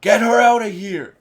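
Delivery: mock terrified order, loud and high; panicked yell. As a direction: shout it in a panic for someone to take her away. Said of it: "you can hear the fear in Wilson Fisk's voice as he's like".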